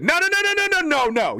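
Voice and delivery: heated run of no's; rapid emphatic no's. Real, not acted. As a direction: shut the idea down fast and high. Emphatically rejects a view.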